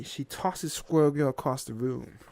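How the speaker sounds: plain reading voice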